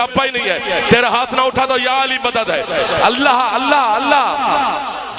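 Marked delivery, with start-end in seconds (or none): 3.0-5.2 drawn-out invocation. Real, not acted.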